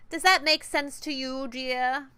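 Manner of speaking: syrupy British threat to the player; British boss voice. Acted. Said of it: "interesting to Huds".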